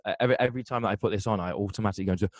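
ordinary delivery

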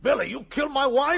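high, loud cry of disbelief; anguished shout. Acted. Husband crying out at Billy over his dead wife, anguished shout. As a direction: shout it as the truth hits.

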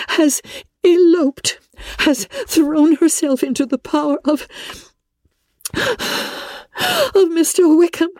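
put-on high, tearful, breaking young woman's voice; pleading through tears, voice cracking; Elizabeth in distress. Elizabeth telling Darcy of Lydia's elopement, tearful.

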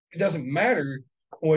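ordinary talk

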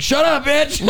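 high, laughing mock shout; mock yell at mom. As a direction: yell it back like a bratty teen, half laughing. Teen snapping back at mom.

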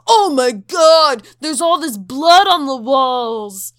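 overacted ghost-hunter amazement; mock shocked gasp. Crew shocked by blood.

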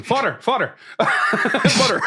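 high-pitched Newfie calls, with laughter; Newfie calling out. Retold or imitated. Groom's cousin calling to his parents, amused Newfie impression. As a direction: mimic a Newfoundlander hailing parents across a room. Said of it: "like, calling across the room".

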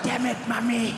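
high mimicked curse, held back from full volume; mouthing along. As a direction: echo the friend's outraged catchphrase under restraint. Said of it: "I'd mouth it next to him".

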